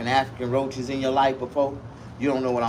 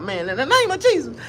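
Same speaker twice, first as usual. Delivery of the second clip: much higher, pleading panicked cry climbing with each phrase; panicked plea. Retold or imitated. Exterminator fleeing the apartment, high panicked pleading cry. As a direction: beg for rescue as the bugs bite. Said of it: "talking about".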